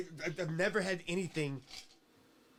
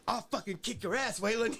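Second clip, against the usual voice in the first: raised pitch, mock threat; an outraged pastor threatening Waylon, in a mock yell; imitating an angry preacher; 6 semitones higher than usual. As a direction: bark it like a furious preacher. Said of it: "he was pissed the fuck off".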